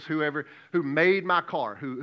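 usual speaking voice

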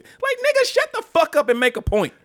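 high, loud mock-angry yell; exasperated outburst. Heckling the rambling candidate, exasperated outburst. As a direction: burst out in fed-up disbelief.

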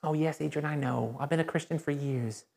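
low, soft, dismissive acknowledgement; imitated know-it-all believer, hushed; complacent churchgoer. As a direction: brush it off quietly, pitch dropped.

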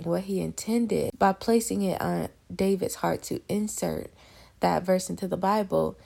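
normal talking voice

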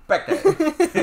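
very high, loud mocking name; French accent. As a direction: squeal it like the maitre d'. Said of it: "he keeps calling him Pecker".

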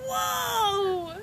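high, drawn-out stunned "whoa". Retold or imitated. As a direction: gasp it out, floored. Classmates' stunned reaction.

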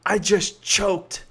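slow, dramatic self-blame; emotionally charged. Shown as a demonstration. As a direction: drag out the words like it's a disaster. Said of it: "One is emotionally charged".

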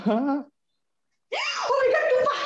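teriak kaget yang panjang dan tinggi. Real, not acted. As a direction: seruan panik tiba-tiba. Baterai habis, kaget. (kaget setengah berteriak)

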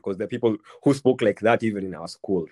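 usual speaking voice

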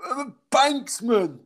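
high, slow dopey voice; imitating a spaced-out lad; mock dopey lad. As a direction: play the gacked bloke drawling out his job.

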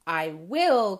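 loud little owlet insisting; loud, determined call from a small bird; crying out defiantly. Acted. Small owlet answers the others, crying out. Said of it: "cried four".

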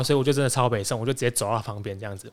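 ordinary delivery

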